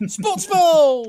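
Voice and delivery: high, very loud drawn-out shout; mock cheer. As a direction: bellow it like a jokey sports chant. Jokingly bellows a sports cheer.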